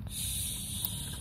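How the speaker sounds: a breathy hush to keep it quiet; soft whispered shh; whispered shush. Acted. Shushing while playing with toys.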